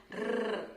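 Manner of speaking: Rrr trilled behind the teeth; rolled r. Shown as a demonstration. Teacher showing the tongue trill to students. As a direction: roll the Rrr on the tongue tip. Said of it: "a letra R vibra atrás dos dentes".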